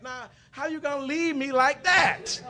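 high, loud, drawn-out mock outrage; indignant protest. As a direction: wail the complaint after her, slow and loud. Acted protest as she walks away.

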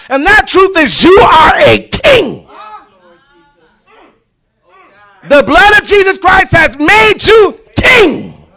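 loud, high shouting sermon; rally the church, hammering every line; fiery pulpit preaching; preaching at full force. Acted. Preaching builds to a shouting climax.

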